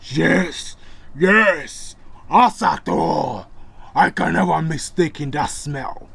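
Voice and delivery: loud, slow menacing beast voice; growling wolf man. Acted. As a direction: sniff out your prey, threatening. Wolf man recognizes Thor.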